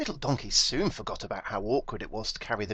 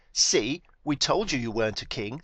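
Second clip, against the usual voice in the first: sneering; 3 semitones higher, 5 dB louder than usual. A narrator's reading. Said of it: "sneered Biggest Donkey".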